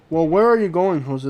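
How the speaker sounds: normal talking voice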